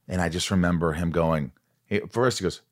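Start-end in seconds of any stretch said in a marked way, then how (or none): none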